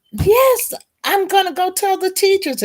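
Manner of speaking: high, thrilled rush to share good news; retold own delight, high and eager. Retelling her own eager reply.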